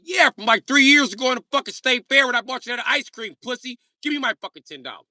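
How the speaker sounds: high, loud street yell; loud Jacob shout. Retold or imitated. Jacob yelling at Terry for his money. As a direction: shout it at Terry across the room. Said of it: "Jacob gonna be like".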